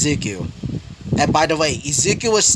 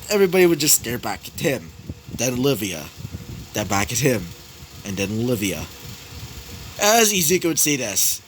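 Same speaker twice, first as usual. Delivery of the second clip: ordinary delivery, nothing marked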